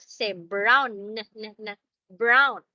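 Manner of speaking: "brown" with a strong, exaggerated B at the start; clear B in brown. Shown as a demonstration. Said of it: "look at my mouth".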